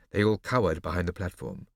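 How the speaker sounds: plain reading voice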